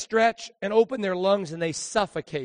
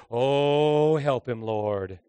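pitying murmur; 4 semitones lower, 4 dB louder, much slower than usual. Retold or imitated. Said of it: "they'll all do this".

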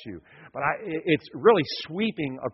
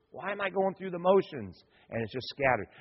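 normal talking voice

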